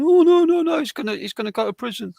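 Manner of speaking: high, loud frantic pleading; panicked mum. Retold or imitated. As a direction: imitate a frightened mum begging. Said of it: "my mum's going".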